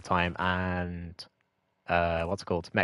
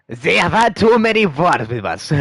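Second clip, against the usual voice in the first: a rough, slightly higher and louder put-on French accent; mock French accent; 5 semitones higher, 4 dB louder than usual. Explaining why the French dislike the British, in a mock French accent. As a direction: ham up a half-hearted French accent as the French. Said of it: "I don't know what that accent was".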